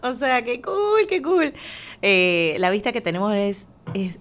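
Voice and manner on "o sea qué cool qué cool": excited squeal. Real, not acted. Emocionada al ver la vista.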